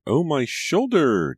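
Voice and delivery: very high, loud and slow wailing shriek; drawn-out shriek. A narrator's reading. Scalded daughter at dinner, long loud high shriek. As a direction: stretch the pain into a long howl. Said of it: "Julia shrieked".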